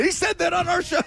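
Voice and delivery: high-pitched indignant yell; Costanza-like outburst. Retold or imitated. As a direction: retell it as an exasperated, squealed protest. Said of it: "closest you've ever been to George Costanza".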